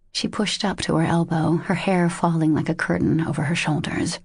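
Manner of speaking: ordinary delivery, nothing marked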